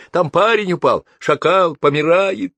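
high, loud shouting in a panic; panicked high-pitched yell; frightened yelling. A narrator's reading. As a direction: shout for urgent help over the noise. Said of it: "от страха не слыша самого себя".

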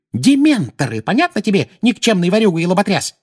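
put-on high, loud scolding old woman; furious high-pitched tirade; angry shouting. A narrator's reading. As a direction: yell abuse at a useless rogue. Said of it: "закричала миссис Фиг".

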